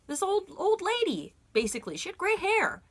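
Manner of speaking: excited. Real, not acted. Excited retelling of old lady.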